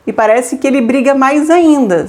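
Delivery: usual speaking voice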